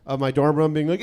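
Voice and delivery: ordinary talk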